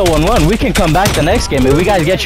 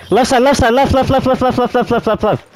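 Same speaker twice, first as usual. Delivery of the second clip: urgent callout; 8 semitones higher than usual. Real, not acted.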